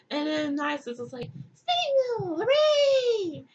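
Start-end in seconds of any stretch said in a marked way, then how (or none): 1.6-3.5 very high, squealed hooray; high, loud burst of pretend glee; mock naive cheering. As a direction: cheer like an easily delighted dupe. Isis cheering at the invitation.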